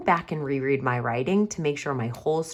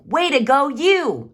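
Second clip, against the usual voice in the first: much higher and louder praise; big excited mom cheer; strong feeling; 11 semitones higher, 9 dB louder than usual. Shown as a demonstration. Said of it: "She said it with a strong feeling".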